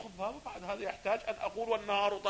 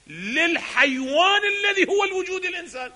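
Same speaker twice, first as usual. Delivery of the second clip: shout; 10 semitones higher, 8 dB louder than usual. Real, not acted. Heated argument against the author.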